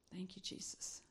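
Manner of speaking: soft breathy whisper of gratitude; whispered thanks. Real, not acted. Preacher whispers thanks to Jesus after her story. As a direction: barely voice the thanks.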